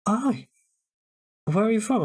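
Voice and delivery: retold stranger's greeting. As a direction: lightly act out a stranger striking up a chat. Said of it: "peers over and goes".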